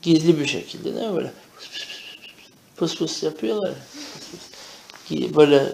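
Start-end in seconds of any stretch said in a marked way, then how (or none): 1.6-2.0 breathy unvoiced pıs pıs pıs, barely audible; whispered pss sound. Shown as a demonstration. Teacher showing students how people whisper secretly, hushed hiss. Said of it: "kısık sesle". 4.1-5.3 breathy unvoiced pıs pıs pıs, barely audible; whispered pss sound. Shown as a demonstration. Teacher showing students how people whisper secretly, hushed hiss. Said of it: "kısık sesle".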